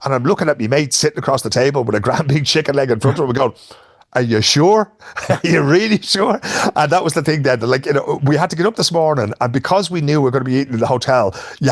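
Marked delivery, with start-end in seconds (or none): 4.1-6.4 laughing, incredulous teasing; drawn-out joking doubt while laughing; mock disbelief. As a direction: needle her with a grin. Teasing the waitress about the chicken.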